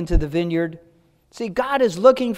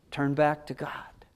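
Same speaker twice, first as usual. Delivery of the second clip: hushed, unvoiced plea; preacher whispers the call to return; 4 semitones lower, 9 dB quieter than usual. Real, not acted. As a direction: whisper it gently like an invitation. Soft closing appeal.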